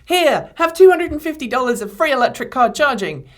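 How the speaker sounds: hammed-up sarcastic company voice; mocking Nissan. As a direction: parody a smug company handing out a gift.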